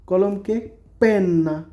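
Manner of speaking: পেন্না with a held double n; slow, careful পেন্না; Italian word. Shown as a demonstration. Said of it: "teaching vocabulary".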